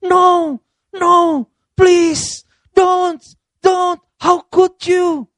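high, drawn-out hysterical movie wife; mock screaming. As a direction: wail in hammed-up protest at the husband. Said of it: "[name] teriak-teriak".

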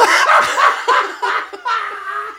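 high-pitched burst of laughter; big laugh. Real, not acted. Host cracking up after parodying the caller, high laughter. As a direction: laugh hard at your own bit.

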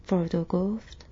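ordinary delivery

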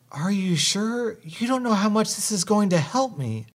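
a pale hint of crying, high and pleading; crying delivery girl. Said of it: "she starts crying".

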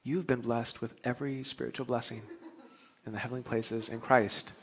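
softly voiced little inner voice; a gentle nudge from inside; quiet inner voice. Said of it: "It says".

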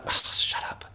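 hushed, sighing mock exasperation; weary, breath-heavy brush-off; exasperated dismissal. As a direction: groan it under your breath, fed up. Exasperated reaction to keynote speakers.

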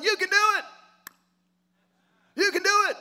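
high, loud cheering coach; God cheering you on. Pretend. God cheering on his child, excited coach voice. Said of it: "He's like".

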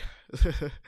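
brief chuckle; short laugh. Real, not acted. Storyteller slips on tears line, brief laugh.

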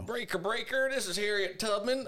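high-pitched hammed-up radio caller. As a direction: call it out over the CB, way up high. Mock CB radio call.

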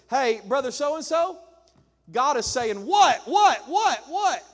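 loud high what what what what; mock shout. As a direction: bark out the whats like God yelling. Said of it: "and he goes".

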